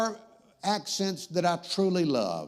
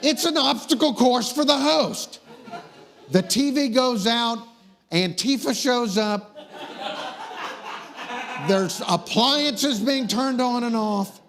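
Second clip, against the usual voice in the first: higher, raised comic rant; exasperated rant; 5 semitones higher than usual. Real, not acted. Host griping about an obstacle course show, exasperated rant. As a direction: complain over the laughter, exasperated.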